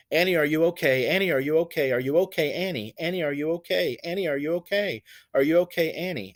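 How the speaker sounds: rhythmic chant of the refrain; song lyric recitation. Shown as a demonstration. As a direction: repeat the refrain to the song's beat. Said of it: "we have the refrain".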